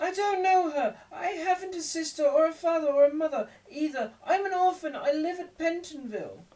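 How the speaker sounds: high, loud frightened pleading; alarmed boy. A narrator's reading. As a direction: Oliver begging the crowd to believe him. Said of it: "greatly alarmed".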